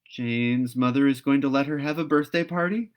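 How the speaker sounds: put-on excited girl hoping she's right; eager Anne. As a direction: guess with excitement. Anne's second guess.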